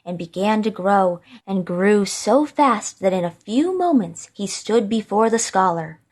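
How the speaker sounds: ordinary delivery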